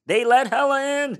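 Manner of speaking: high, loud burst of excitement; excited news. Acted. Steven tells Jackie about the fancy doggy daycare email, excited. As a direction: exclaim it, pitched right up.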